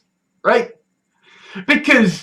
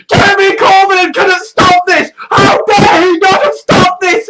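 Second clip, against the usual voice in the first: higher, louder imitated outburst; mock rant; 8 semitones higher, 4 dB louder than usual. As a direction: ham up the furious writer venting.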